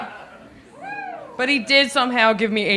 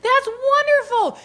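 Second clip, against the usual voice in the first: genuinely excited; 11 semitones higher than usual. Retold or imitated. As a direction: gush with delight. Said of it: "And I was like".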